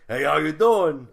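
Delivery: Sopranos tough guy Boston accent; loud Boston wiseguy greeting. Shown as a demonstration. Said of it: "Boston accent".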